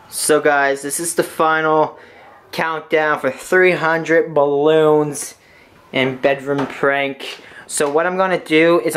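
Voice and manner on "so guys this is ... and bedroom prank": slow, drawn-out countdown announcement; dramatic announcer. Real, not acted. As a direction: stretch it like a big reveal.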